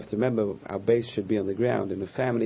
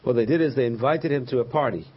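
ordinary talk

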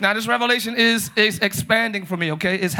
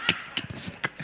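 voiceless whisper, only consonants heard; tiny hushed whisper with crisp consonants; barely audible whisper; 4 semitones lower, 14 dB quieter, faster than usual. Shown as a demonstration. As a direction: whisper so only the C clicks through. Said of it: "I should only hear the c".

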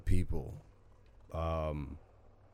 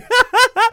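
laughing; 13 dB louder than usual. Real, not acted. Big laugh at his own joke.

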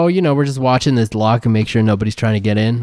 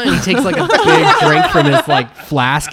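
laughing while narrating, higher; 5 semitones higher, 3 dB louder than usual. Real, not acted. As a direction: crack up mid-sentence.

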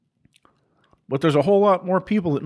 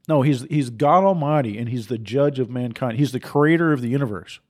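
normal talking voice

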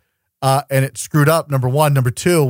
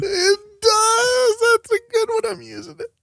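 squealing laughter. Real, not acted.

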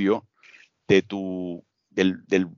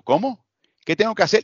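"hacer" echoed back as "hacel", pitched much higher in mock disbelief; incredulous echo; 10 semitones higher than usual. Interrupting the student to correct his R, pitched much higher and disbelieving. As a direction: toss the mistake back as a puzzled, much higher question. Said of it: "lo interrumpo".

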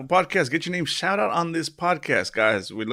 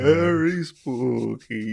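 spooky voice; much slower than usual. Acted. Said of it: "least spooky voices".

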